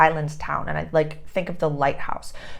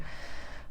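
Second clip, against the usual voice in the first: long unvoiced sigh before complaining; exhausted exhale; weary sigh; 15 dB quieter than usual. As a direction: sigh like a tired waiter. Tired waiter retelling full moon shifts.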